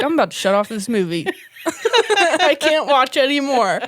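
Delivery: laughing through the words; giggling it out, can barely get it said; mock-outraged quote broken up by real laughter. Real, not acted.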